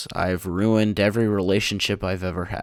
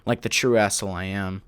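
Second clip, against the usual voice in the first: ordinary delivery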